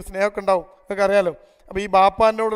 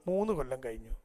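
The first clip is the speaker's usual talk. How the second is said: tearful murmur; 7 semitones lower, 13 dB quieter than usual. Retold or imitated. Tearful daughter answers counselor.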